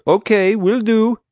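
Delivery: loud, very high call; feigned compliance. Pretend. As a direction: cheerfully agree over the racket. Pretends to agree over noise.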